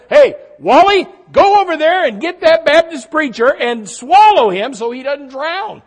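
high, loud hammed-up God calling out; God ordering the whale to swallow the preacher. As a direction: call the whale over like a buddy.

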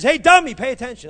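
loud, high mock scold on "Hey, dummy"; God scolding the preacher to pay attention, loud and high. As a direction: call him out like an exasperated God. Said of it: "but he says".

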